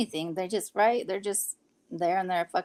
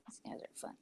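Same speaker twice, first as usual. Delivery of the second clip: whispered aside, half to herself; 18 dB quieter, much faster than usual. Real, not acted. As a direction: breathe it out as a private remark. Aside while on live stream.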